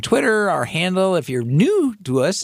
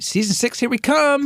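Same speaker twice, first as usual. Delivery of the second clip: excited; 10 semitones higher than usual. Real, not acted. Host announcing sixth season.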